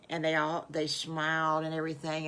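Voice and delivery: plain reading voice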